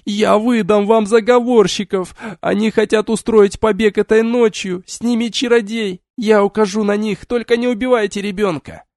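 put-on screaming to save her child; frantic shouting hostage taker. A narrator's reading. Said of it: "безумный вопль".

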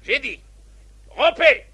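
loud, high barked order; drill sergeant. Acted. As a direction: dismiss them sharply. Captain dismissing the ranks.

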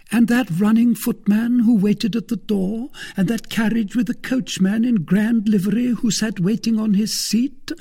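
an eager wife pressing her case; lawyer's wife voiced high. A narrator's reading.

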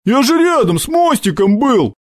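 high-pitched whiny protest; whining. A narrator's reading. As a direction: whine an excuse to the boss. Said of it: "заныл Сашок".

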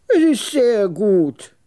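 high, slow, self-assured pronouncement; lofty old man's voice; superior old trader. A narrator's reading. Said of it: "er gehabte sich sehr überlegen".

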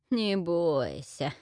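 slow, low gurgling chuckle; gurgling witch laugh. Acted. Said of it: "забулькала, смеясь, ведьма". (булькающий смех ведьмы)

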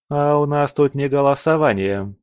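высокая, твёрдая отповедь; осади спорщика; осаживая. A narrator's reading. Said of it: "осадила его Алина". (строго, повышенным тоном)

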